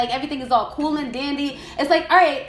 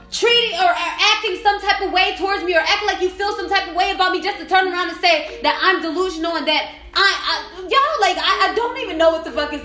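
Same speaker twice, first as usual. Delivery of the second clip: higher, heated volley of questions; angry accusation; 5 semitones higher than usual. Real, not acted. Confronting the person over abuse and manipulation, indignant. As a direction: confront them, indignant and insistent.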